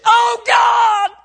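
full-force high scream of a horrified father; high, drawn-out anguished yell; anguished shout. Retold or imitated. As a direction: cry out in desperate alarm, very loud. Father sees his son struck.

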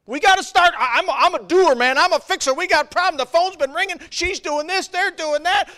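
high, loud, fast panicked rambling; frantic planning. Retold or imitated. Joshua to his team, high and loud frantic scheming. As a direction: spill out plans like a leader losing his grip.

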